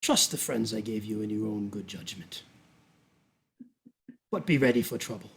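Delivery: low, measured briefing with deliberate pauses; sinister duke. Acted. Duke sending Bridget to a presentation, coolly commanding. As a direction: hand down instructions with unhurried authority. Game master plays the Duke.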